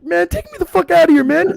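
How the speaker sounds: high and rushed, desperate to leave; mock-frantic friend pleading to be taken out; panicked plea. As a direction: beg to get out fast. Terry begs to leave.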